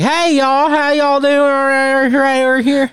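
very high, slow American caricature; exaggerated American accent. American abroad greeting the locals, exaggerated American accent. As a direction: ham up a folksy American y'all greeting. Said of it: "talking about exaggerating accents".

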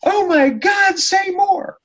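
very high, giddy woman's squeal; excited woman. Retold or imitated. Lady at ringside urging him on, squealing. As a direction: squeal it like a thrilled fan. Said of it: "she keeps saying".

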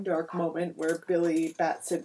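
normal talking voice

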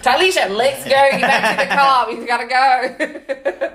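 telling her friend to leave fast, loud and laughing; urgent call to friend. Retold or imitated. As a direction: call your friend away, laughing and pressing. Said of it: "I go".

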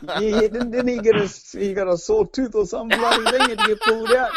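high, giggly laughing words; real laughter over the words; laughing while talking. Real, not acted. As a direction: laugh your way through the question. Teasing mate about his trip.

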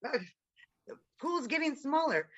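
ordinary talk

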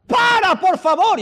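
very high-pitched yelled plea; acted-out prophet's desperate shout; desperate pleading. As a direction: beg God at the top of your voice. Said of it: "qué le dice Elías a Dios".

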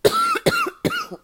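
harsh real cough; a sudden hacking cough; coughing fit. Real, not acted. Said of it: "Excuse me if y'all hear me coughing".

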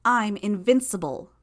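loud, high, triumphant shout. Acted. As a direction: bellow it, arrogantly. Hero boasting, shouting.

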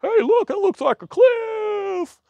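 very high, slow, dopey; sing-song simpleton at the cliff edge; high slow cartoonish sheep voice; mocking sheep voice. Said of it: "all those little sheep just go".

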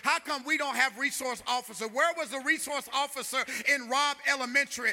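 impassioned pulpit outcry; fiery preaching. Real, not acted. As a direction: preach it hard, climbing to a full-voiced demand. Preaches at full force.

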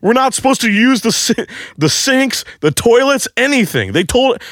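very high, frantic ranting; outraged scolding. Retold or imitated. Coworkers to the man who used the toilet, high outraged rant. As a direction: rant in disbelief, voice stuck way up high.

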